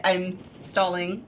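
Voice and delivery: usual speaking voice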